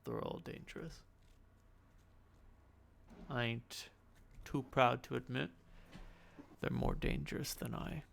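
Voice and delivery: hushed in-character storytelling; cryptic game character. Acted. Game master voices character, narrates.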